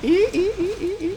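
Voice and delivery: high imitating mouse squeaks; squeaking like a mouse. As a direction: copy the mouse squeak back. Echoes friend's squeaks laughing.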